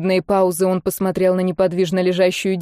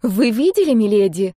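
put-on surprised, smiling girl. A narrator's reading. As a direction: ask in pleased surprise. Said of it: "удивлённо улыбнулась она". (с радостным удивлением)

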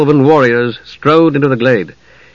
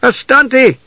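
high, ringing foreign order; sharp command; 12 semitones higher than usual. A narrator's reading. Half-elf orders elven archers to stop, sharp command. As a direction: call a halt sharply. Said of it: "came the sharp command".

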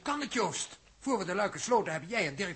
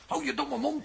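higher, sharp put-down; angry rebuke; 8 semitones higher than usual. Acted. Huub snaps at Dirk to shut up, angry. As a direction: cut him off with contempt.

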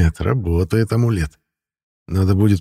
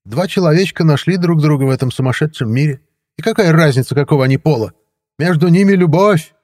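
high, loud affected voice; mincing; 10 semitones higher, 6 dB louder than usual. A narrator's reading. Third neighbour mincingly correcting the woman, high and loud. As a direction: say it prissy and mannered. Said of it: "жеманно сообщил".

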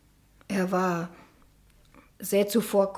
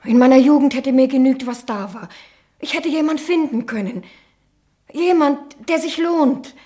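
loud, higher, rising reproach; bitter outburst; 5 semitones higher, 6 dB louder than usual. Acted. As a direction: let the years of frustration burst out. Nichte wirft der Tante vor. (Nichte wirft der Tante laut ihr vergeudetes Leben vor)

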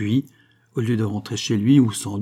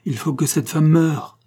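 raised, higher command; firm demand; 6 semitones higher, 4 dB louder than usual. Acted. Banco orders Don José that the woman die. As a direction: insist she must die.

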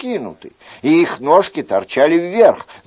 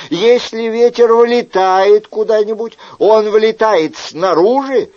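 higher, louder mock-patient reasoning; reasoning Chicot; 8 semitones higher, 4 dB louder than usual. Chicot lecturing the innkeeper with mock patience, higher and louder. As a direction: lecture the host step by step.

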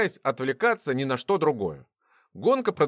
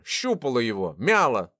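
plain reading voice

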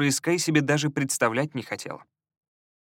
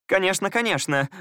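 chirpy high sweet lady; cartoon princess; 7 semitones higher than usual. A narrator's reading. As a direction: gush it eagerly, higher. Elizia answers the wizard.

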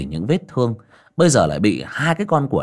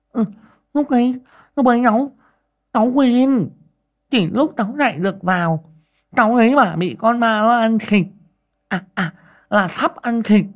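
high, stammering peasant woman; nervous woman explaining the flashlight; flustered Dậu; 13 semitones higher, 4 dB louder than usual. A narrator's reading. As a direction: fumble for an excuse, voice up high.

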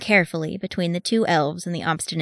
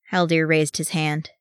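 ordinary delivery

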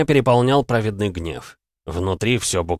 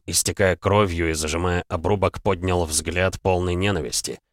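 ordinary delivery

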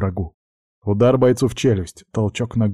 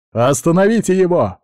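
high, loud shout; barking gym coach; 8 semitones higher, 5 dB louder than usual. A narrator's reading. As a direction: yell the order across the gym. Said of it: "закричал тренер".

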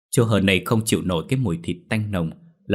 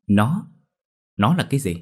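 sharp higher disbelieving question; astonished; 4 semitones higher than usual. Acted. Măng stunned by the elder's hint, asking in surprise. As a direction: jump up in pitch in shock. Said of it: "ngạc nhiên tột độ cất tiếng hỏi".

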